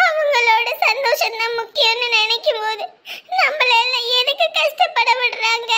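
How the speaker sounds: sobbing, pitch pushed up; upset girl telling her elder sister about her parents, tearful; tearful pleading. Acted. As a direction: cry it out between broken laughs. Girl pours out grief, sobbing.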